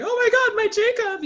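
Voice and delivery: high, loud hammed-up wail of my Jacob; mock distraught fan. Mocking the housemates freaking out over Jacob, high wail. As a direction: overplay the dramatic dismay. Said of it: "you see Muna being like".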